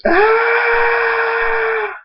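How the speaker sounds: very high sustained scream; long scream. A narrator's reading. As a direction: scream out as if hit. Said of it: "Thunderstorm screams".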